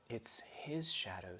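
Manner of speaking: slow hushed whisper; awed whisper. Acted. Lucy telling Edmund what she sees, hushed whisper. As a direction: breathe it in wonder.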